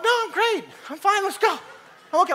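high-pitched mock reassurance; playing it off. Hurt father to his son, playing it off. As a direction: insist too quickly that all is fine. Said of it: "play it off like we're totally fine".

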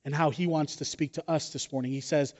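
usual speaking voice

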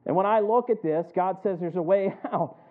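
loud, rising pulpit cadence; preaching at full force. Acted. Pastor warning the congregation about Satan, loud preaching. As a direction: drive it home to the congregation.

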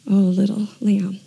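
ordinary talk, nothing marked

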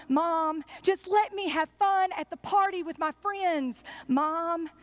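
a hint of an exasperated young Jesus; pleading son. Jesus answering his mother, pleading.

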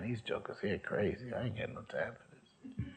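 soft, trailing grumble to himself; muttered aside. Retold or imitated. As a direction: say it under your breath, dropping away. Retold muttered thought about the circle.

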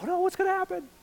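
very high-pitched panicked squeal; mock freak-out. Pastor acting out his inner worry, mock freak-out. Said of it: "I kind of freak out".

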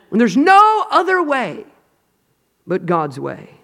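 loud, high, drawn-out cry; preacher's climactic shout; impassioned preaching. Real, not acted. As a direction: stretch it out at full force.

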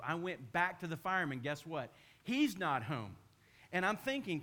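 ordinary talk, nothing marked

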